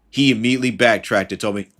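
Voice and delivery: ordinary talk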